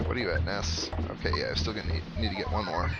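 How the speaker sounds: normal talking voice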